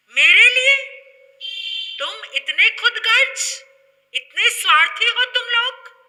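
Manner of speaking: high, very loud indignant tirade; outraged scolding. Acted. Jogan berating selfish villagers who expelled the monk, outraged. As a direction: explode in loud, high-pitched reproach.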